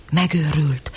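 ordinary delivery

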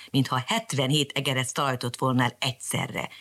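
usual speaking voice

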